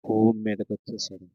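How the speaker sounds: ordinary talk, nothing marked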